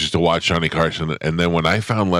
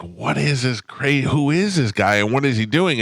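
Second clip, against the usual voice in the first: baffled; 6 semitones higher than usual. Retold or imitated. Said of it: "I was like".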